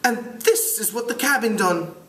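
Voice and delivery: high British schoolboy voice; British accent. Acted. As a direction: play a piping British boy. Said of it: "try to do it with a British accent".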